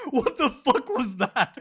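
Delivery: slow, loud, laughing disbelief; real laughter at a baffling moment. Retold or imitated. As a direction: draw out the question while cracking up. The friends laughing at the man.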